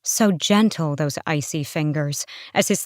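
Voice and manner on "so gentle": ordinary delivery, nothing marked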